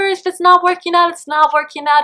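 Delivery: ordinary talk, nothing marked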